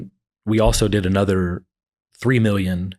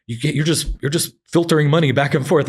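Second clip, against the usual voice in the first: mild mock yell, higher-pitched; retold yelling; 6 semitones higher than usual. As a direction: imitate an exasperated partner's complaint, pitched up. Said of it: "my partner's in there yelling at me".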